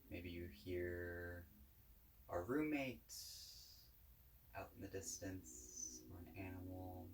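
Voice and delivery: calm, unhurried guided-meditation voice. Real, not acted. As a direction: guide listeners gently, with long pauses between phrases.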